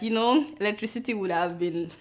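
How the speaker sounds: ordinary delivery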